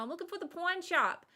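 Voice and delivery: half-hammed shout in an East Coast accent; mock yell. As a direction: imitate mom hollering for the pawn shop. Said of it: "yelling at this poor person".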